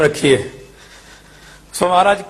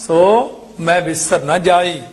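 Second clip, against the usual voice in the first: ਸੋ, a clear pause, then ਮੈਂ ਵਿਸਰ ਨਾ ਜਾਈ; comma after ਸੋ; slower than usual. Shown as a demonstration. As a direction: set ਸੋ apart before ਮੈਂ. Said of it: "ਸੋ ਤੇ comma".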